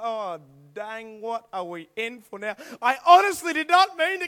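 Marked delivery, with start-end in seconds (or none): 0.0-2.5 low, soft, drawn-out dismay; sinking dread. Acted. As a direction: groan it softly as the sentence grows. Acted dread at growing punishment.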